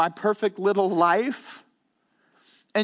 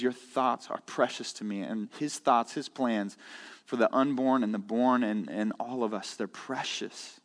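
hushed scripture quote; 9 semitones lower, 7 dB quieter than usual. Acted. Quoting psalm hushed mid-sermon.

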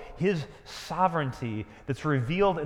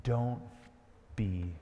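soft gentle reassurance; 5 semitones lower, 8 dB quieter than usual. Acted. Jesus voiced softly, pauses.

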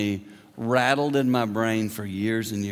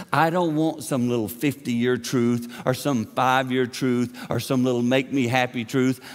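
usual speaking voice

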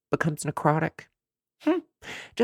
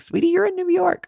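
sassy retort. Retold or imitated. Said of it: "I was like".

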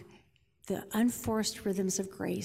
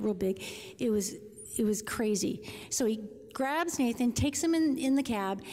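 normal talking voice

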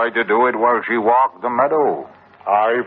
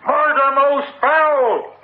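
faked cry of murder; slower than usual. Killer shouting a fake alarm to rouse the house. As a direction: scream for help as if discovering a crime. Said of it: "And set up a cry".

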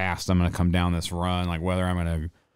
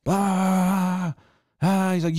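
mock screaming; 8 semitones higher than usual. Storyteller imitating a man screaming at teenagers, mock screaming. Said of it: "is screaming at fucking fourteen-year-old kids".

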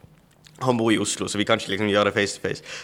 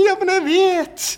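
excited reply; 5 dB louder, slower than usual. Retold or imitated. Acted reply to shocked friend.

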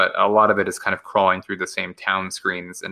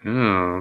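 thinking hmm. Real, not acted. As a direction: hum while mulling it over. Pondering a listener's question.

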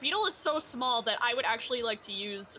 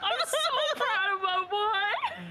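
gushing pride; 8 semitones higher than usual. Pretend. Friend hears Hart learned to read and write, gushing pride. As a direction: coo tearfully over your boy, hammed up.

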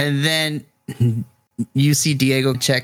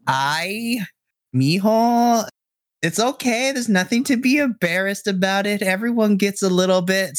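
higher, tender teasing mother; soothing mom; 7 semitones higher than usual. Acted. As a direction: coo at him like a mom.

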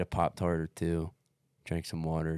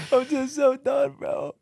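much higher, louder half-laughing whimper; mock whimper; 6 dB louder, slower than usual. Runner recalling crying in the tent, in a high half-laughing whimper. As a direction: squeak it out like you're about to break.

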